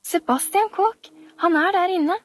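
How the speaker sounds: high, eager announcement; a little girl bursting with news; high-pitched eager child. Acted. Camilla eager, pointing at room.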